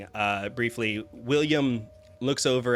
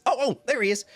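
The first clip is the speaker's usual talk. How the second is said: much higher and louder, delighted to spot a friend; excited greeting; 13 semitones higher, 5 dB louder, much faster than usual. Acted. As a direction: call out gleefully across the room. Roby spots Oliver.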